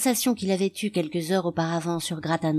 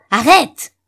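put-on loud, high angry yell at the baby; furious shout; 7 semitones higher, 12 dB louder than usual. A narrator's reading. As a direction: snap it out at full volume in rage. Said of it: "il cria".

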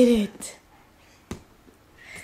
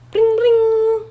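pleading cry; 9 semitones higher, 6 dB louder than usual. Real, not acted. Urging dog, crying out.

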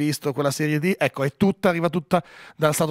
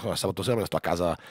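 sceptical TV executive; 8 semitones lower, 5 dB quieter than usual. Retold or imitated.

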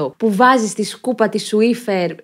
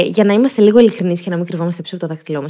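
ordinary talk, nothing marked

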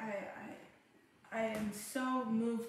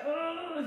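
higher, drawn-out mock moan; imitated pained moan; 7 semitones higher, 3 dB louder than usual.

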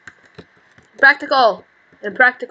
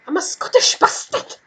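half-voiced, higher botched Scottish accent; bad Scottish accent; 7 semitones higher than usual. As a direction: attempt a Scottish accent again, badly. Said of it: "I don't have a good accent in Scottish".

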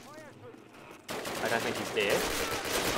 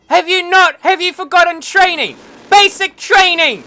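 loud, much higher furious yell; angry scolding; 9 semitones higher, 7 dB louder than usual. Real, not acted. Gamer scolding soldier Fleming for walking into gunfire, furious yell. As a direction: tear into him like a drill sergeant.

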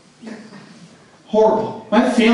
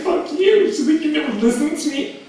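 mock angry girlfriend; 8 semitones higher than usual.

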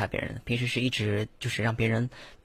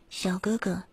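just a little higher, soft; slightly raised voice; 7 semitones higher, 6 dB quieter, slower than usual. Shown as a demonstration. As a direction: lift the pitch only a bit. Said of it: "就直接".

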